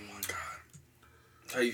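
breathless whispered exclamation. Real, not acted. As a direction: gasp it out unvoiced. Reacting to spicy noodles.

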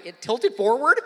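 ordinary talk

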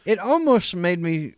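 usual speaking voice

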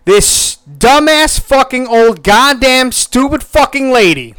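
loud, high rant reading out a furious text; all-caps outrage at the dumb lady. Retold or imitated. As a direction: yell it like a message typed in all caps. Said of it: "I just said in all caps".

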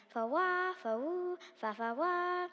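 high sung horn toots; horn blast imitation. A narrator's reading. Grinch announcing his arrival to Whoville, imitated horn blasts. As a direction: toot it like a festive horn. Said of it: "blew it as loudly as he could".

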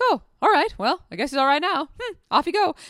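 a bright relieved send-off to the dog; pleasantly surprised relief; relieved surprise. Retold or imitated.

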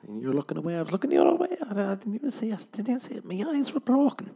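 high-pitched excuses climbing to a mock wail; dawning dismay. Oblivious friend realising what happened, mock panicked excuses. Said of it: "And then you're like".

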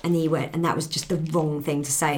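ordinary talk

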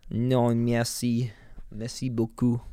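slow, drawn-out mock French accent; clumsy put-on French accent. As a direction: stretch out Non merci like a bad French tourist. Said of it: "The worst French accent I ever heard".

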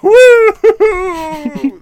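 loud, high whoop of triumph; celebratory woo. Real, not acted. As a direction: cheer it out like a victory lap. Celebrating wrap of the episode.

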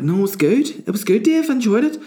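high-pitched mock reassurance from friends; friends reassuring. Friends telling him the show was good, mock reassuring. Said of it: "some other people who've seen it were like".

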